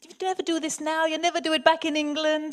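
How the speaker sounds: coaxing in a put-on Antiguan accent. Boatman coaxing a nervous tourist off the boat, imitated Antiguan accent. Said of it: "The Antiguan guy goes".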